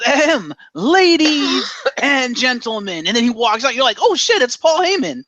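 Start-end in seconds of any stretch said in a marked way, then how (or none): 0.0-3.1 throat-clear, then high drawn-out call; mock grand introduction, stretched slowly; Paul Heyman. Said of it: "you just hear this faint voice". 4.0-5.2 high-pitched mock astonishment; startled realization. Acted audience reaction to reveal.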